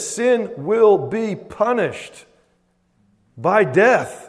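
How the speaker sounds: slow, high, forceful declaration; emphatic divine pronouncement. Acted. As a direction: hammer out each word as God's verdict. Preacher declaims God's pronouncement.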